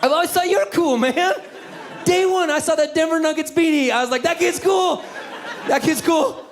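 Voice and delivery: very high, squeaky fanboy gush; overjoyed gushing. Retold or imitated. Gushing reply to kid's compliment.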